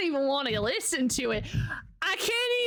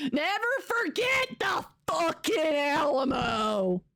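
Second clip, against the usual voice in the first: mock battle cry. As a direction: holler it like a rousing Texan war cry.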